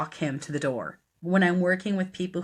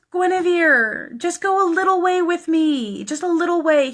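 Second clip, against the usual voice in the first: imitated begging; 12 semitones higher, 8 dB louder than usual. Dying man asks his healer along, imitated begging. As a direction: coax her by name, pitched right up. Voicing Billy begging again.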